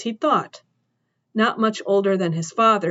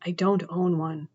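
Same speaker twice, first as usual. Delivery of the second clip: dry-mouthed answer; 3 semitones lower, 5 dB quieter than usual. Acted. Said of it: "His mouth was a desert".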